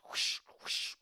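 unvoiced rushing whoosh. A narrator's reading. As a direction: breathe out the sound of running steps. Footsteps through mud.

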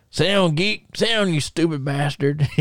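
put-on country accent. Wrestler teasing his friend to crack him up, mock country accent. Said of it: "he'll, he'll, he'll go".

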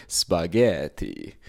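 slow, drawn-out mock Italian spaghetti; Italian accent. Comedian winding up his aunt, in a slow, hammy mock Italian accent. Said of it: "putting on an Italian accent for spaghetti".

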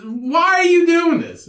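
high, slow mock plea; retold exasperation. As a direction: stretch it out high in disbelief.

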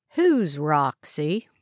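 slow, flat-toned question; matter-of-fact. A narrator's reading. Interrogator questioning prisoner, slow and matter-of-fact. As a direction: ask it like routine business. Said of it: "with a matter-of-fact tone".

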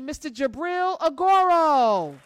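big, slow, high hype announcer; show host intro. Acted. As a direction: announce the guest like a headliner. Host hypes the guest intro.

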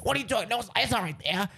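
lightly voiced cartoon husband; mildly exasperated impression of a husband; Elton. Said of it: "why Natalie and Elton sound like that".

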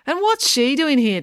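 high-pitched, affronted question; indignant demand. A narrator's reading. April objecting to Loretta being here, indignant demand. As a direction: demand an answer, pitch jumping up. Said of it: "demanded April".

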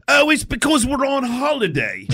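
high, slow fake English accent; bad English accent. Prank caller excuses his area code, bad English accent. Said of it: "with his bad English accent".

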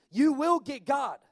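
ordinary talk

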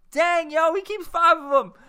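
loud, high-pitched hyped exclamation; mock amazement. Reviewer reacting to a customer's fish count, loud mock amazement.

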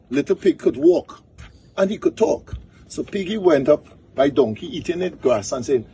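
high-pitched, animated storyteller; folk-tale storytelling. Acted. Tells a donkey folk tale.

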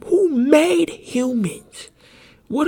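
high, loud, drawn-out demand; indignant high shout; exasperated outcry. Real, not acted. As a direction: cry it out slowly in disbelief. Ranting at faulty human design.

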